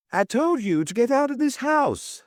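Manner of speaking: high, understated shout; shouting after him. A narrator's reading. Lily ordering Toby out, mild high shout. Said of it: "Lily shouted after him".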